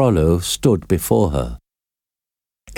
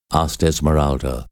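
ordinary delivery, nothing marked

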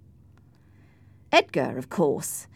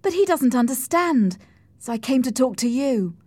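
higher-pitched, worked-up plea; agitated confiding; 6 semitones higher, 3 dB louder than usual. Acted. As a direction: pour out the complaint to Nelly. Cathy confides in Nelly.

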